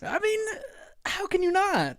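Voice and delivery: can't believe anyone wouldn't; excited. Real, not acted. Insisting to co-host, excited.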